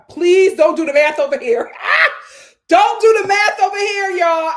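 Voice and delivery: loud, high, begging through laughter; laughing plea. Real, not acted. Streamer begs chat to stop counting her earnings, loud laughing plea. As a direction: beg them loudly, half laughing. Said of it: "pleading loudly with laughter".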